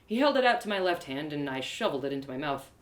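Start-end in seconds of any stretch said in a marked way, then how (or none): none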